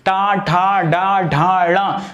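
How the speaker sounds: टा ठा डा ढा णा with the tongue curled back; retroflex demo. Shown as a demonstration. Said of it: "जीभ पीछे की तरफ".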